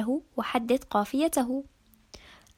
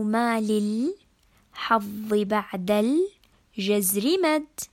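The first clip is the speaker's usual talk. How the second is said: verse broken foot by foot, drawn out with pauses after each chunk; split into metrical feet; 3 semitones lower, much slower than usual. Shown as a demonstration. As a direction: scan the line slowly, "وطني أي" / "ن أنا مم" / "من أود", syllables stretched. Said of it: "نقسمها حسب التفعيلة".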